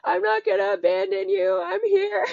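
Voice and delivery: high, loud, tearful crying through the words; sobbing. Retold or imitated. Mother retelling sobbing comfort to her inner child, crying. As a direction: cry out the promise, voice breaking and wailing high. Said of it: "And of course I started sobbing".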